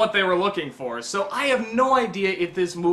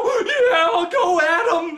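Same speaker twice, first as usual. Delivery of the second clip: much higher, louder laughing cheer; cheering crowd; 10 semitones higher, 5 dB louder, much slower than usual. Retold or imitated. Audience cheering the robot getting up, loud laughing cheer. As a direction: whoop like a fan cheering the robot on. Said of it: "they go".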